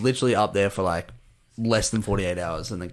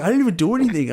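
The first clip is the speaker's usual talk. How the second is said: very high, indignant imitation; mock innocent protest; 10 semitones higher, 3 dB louder, faster than usual. Yawning stranger denying he did anything, high-pitched mock protest. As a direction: squeak out a playful denial.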